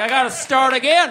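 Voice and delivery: loud, high, laughing exasperation; exasperated shout. Retold or imitated. Doll maker giving up on the cats, loud and exasperated. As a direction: yell it like a frustrated madman. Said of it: "So he'd be like".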